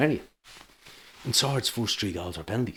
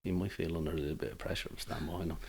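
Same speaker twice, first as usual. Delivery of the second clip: quiet threat; 2 semitones lower, 11 dB quieter than usual. Retold or imitated. Linesman quietly warning referee, soft and understated. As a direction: say it softly, almost privately. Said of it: "I said".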